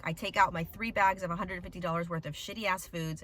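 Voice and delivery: ordinary talk, nothing marked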